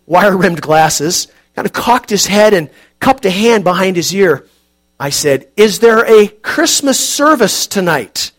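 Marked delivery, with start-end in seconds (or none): 5.6-8.3 slow, deliberate, raised for a deaf ear; raised voice, awkward. Acted. Narrator asks the deaf man slowly and loudly, awkward. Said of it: "I felt awkward raising my voice".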